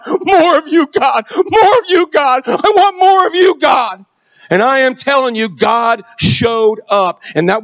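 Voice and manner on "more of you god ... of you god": higher, pleading cries to God; crying out to God. Retold or imitated. Son crying out to God at his baptism, pleading and higher. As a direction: plead upward, straining on more. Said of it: "crying out to God".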